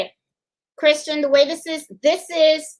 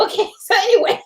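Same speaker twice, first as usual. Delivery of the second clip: laughing; 8 semitones higher, faster than usual. Real, not acted. Laughing off the sangria.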